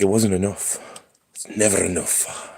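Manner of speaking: low, soft grumbling cat voice; a cat muttering that it is never enough; grumpy cat voice. Retold or imitated. Owner voices his cat, low grumbling and soft. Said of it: "She's like".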